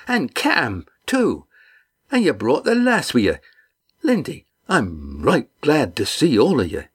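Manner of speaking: a big man delighted to see friends; hearty fisherman. Acted. Red Ian greeting the crew, hearty and glad.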